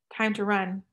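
ordinary delivery, nothing marked

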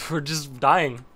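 loud, laughing disbelief; exasperated shout. Real, not acted. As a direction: yell it out as the raid wipes. Raid wipe, laughing exasperation.

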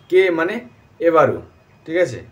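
normal talking voice

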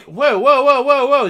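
loud, high string of whoas; alarmed surprise. Real, not acted. Viewer startled at missing part two, loud alarm. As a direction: halt everything with rising volume.

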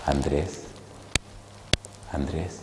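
soft, low, tender calling of the name; a hint of a tender, softly calling voice; gentle calling voice. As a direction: call his name softly, like a loving voice. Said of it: "esa voz tan rica".

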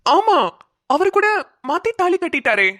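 high, animated recall; surprised recognition. Acted. (வியப்போடு உயர்ந்த குரலில்)

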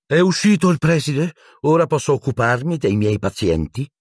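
high-pitched irritable matron; grumpy and put out, pitched well up; bad-tempered. A narrator's reading. Matron checking the headmaster has gone, bad-tempered. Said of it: "Era di pessimo umore".

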